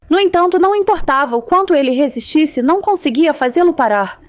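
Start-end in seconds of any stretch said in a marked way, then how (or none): none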